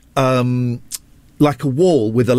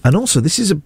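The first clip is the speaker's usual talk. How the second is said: ordinary delivery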